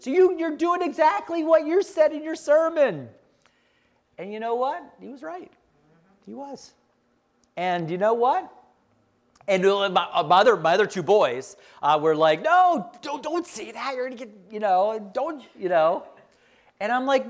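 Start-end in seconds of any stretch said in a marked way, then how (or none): 0.0-3.1 higher, mock child voice calling Dad out; son's voice. Son reminding dad of his sermon, higher mock kid voice. 12.4-16.0 lightly voiced brothers hushing; hint of alarmed boys. Brothers hushing their brother, lightly voiced alarm. Brothers warn against saying that.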